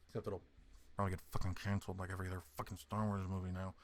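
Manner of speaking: fast low muttered aside. Real, not acted. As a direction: mutter it under your breath, quickly. Muttered aside, then "I didn't say anything.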